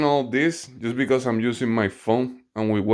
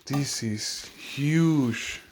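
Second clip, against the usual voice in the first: awed; 6 semitones higher, 4 dB quieter, much slower than usual. Real, not acted. Awed by size of part.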